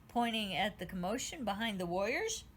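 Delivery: ordinary delivery, nothing marked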